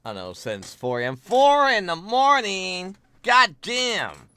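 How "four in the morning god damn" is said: loud, high-pitched disbelief, drawn out; shocked outburst. Real, not acted. Streamer hears viewer was up since four, astonished shout. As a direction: holler it in big amazement.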